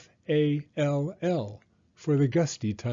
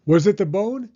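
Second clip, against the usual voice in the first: higher and much louder call; 8 semitones higher, 9 dB louder than usual. A narrator's reading. Rocket calls to the silent bird, loud higher call. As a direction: call across the yard to the bird. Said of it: "he called to the bird".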